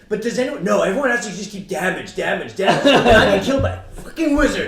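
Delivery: loud high furious shouting; screaming rant. Retold or imitated. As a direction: yell at useless teammates. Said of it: "He's like".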